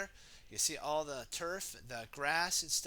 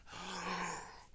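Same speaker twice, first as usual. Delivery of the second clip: horrified gasp; 3 semitones higher, 4 dB quieter than usual. Acted. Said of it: "gasped in horror".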